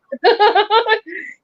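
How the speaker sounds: high-pitched burst of laughter. Real, not acted. Laughing at lowball offers.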